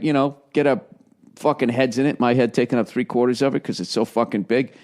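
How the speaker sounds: usual speaking voice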